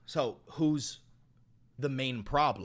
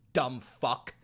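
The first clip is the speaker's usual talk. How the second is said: ordinary talk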